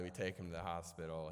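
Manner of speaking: ordinary talk, nothing marked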